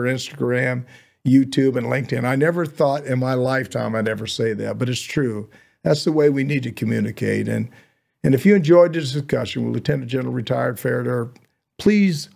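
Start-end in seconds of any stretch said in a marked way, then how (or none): none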